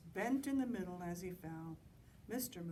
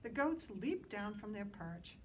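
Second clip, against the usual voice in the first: plain reading voice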